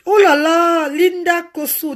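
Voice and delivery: high, gushing cry of the viewer's name; high-pitched delighted greeting to a fan. Real, not acted. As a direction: gush over a dear friend.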